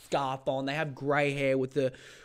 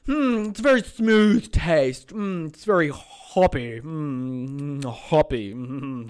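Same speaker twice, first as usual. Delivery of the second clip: slow, savouring snob voice, a bit louder; mock beer snob; 6 dB louder, much slower than usual. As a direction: savour every word like a pretentious taster. Mocking beer snob tasting.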